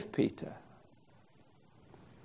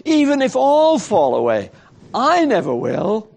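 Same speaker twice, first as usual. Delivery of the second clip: raised, emphatic declaration; boastful vow; 7 semitones higher than usual. Acted. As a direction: vow it confidently, pitched high. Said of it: "that was what Peter's boast had been".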